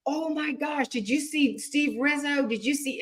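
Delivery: high, soft excited gushing; awed buzz. Retold or imitated. Convention speakers talking up the comedian, awed imitation. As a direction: mimic admirers passing on the buzz.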